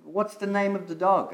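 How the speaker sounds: high, bright curious stranger at the park; curious passerby. Retold or imitated. Passerby at the park asking about the dog, lightly voiced high. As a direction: ask like a friendly stranger meeting a dog.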